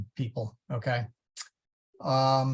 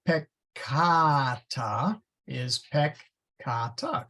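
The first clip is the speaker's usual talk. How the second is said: "peccata" slowly as pek-KAA-ta; long middle stress; much slower than usual. Shown as a demonstration. Teacher showing long-vowel rule, middle-syllable stress. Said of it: "The accent is placed on that syllable".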